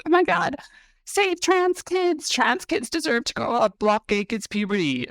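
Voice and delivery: very high-pitched, exaggerated mock activist voice; mocking activist impression. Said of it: "bitches like you, non-binary girls on T, who are like".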